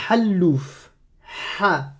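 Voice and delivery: "hallouf" and "Ha" with a raspy, strangled h; Arabic ḥ sound. Shown as a demonstration. Teacher showing "hallouf" with a throaty scraped h. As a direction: push breath through a tight throat on "hallouf". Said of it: "Comme dans".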